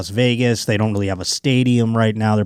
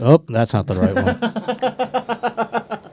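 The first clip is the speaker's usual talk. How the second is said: higher, very slow, embarrassed take-back; mock flustered announcer; 11 semitones higher, much slower than usual. Announcer botching his on-air apology, slow mock embarrassment. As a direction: fumble the correction like a caught broadcaster. Joke apology gone wrong.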